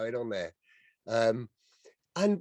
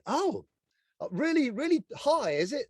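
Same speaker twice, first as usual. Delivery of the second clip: high, lightly impressed query; mock impressed; 9 semitones higher than usual. Speaker asks the site looker about the hotel, high mock wonder. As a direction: act politely amazed at the tall building. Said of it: "and I said".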